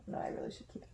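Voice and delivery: whispered aside to herself. Real, not acted. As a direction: drop to a breathy whisper. Whispered aside about the mask.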